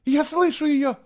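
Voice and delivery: very high, loud outcry; sudden cry. A narrator's reading. Girl hearing her drowned sister, crying out. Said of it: "вдруг выкрикнула Кейла".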